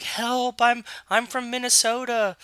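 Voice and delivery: high, pleading cry for help; pleading man in a net. Retold or imitated. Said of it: "And he's like".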